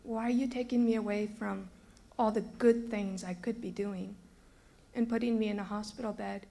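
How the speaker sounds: ordinary talk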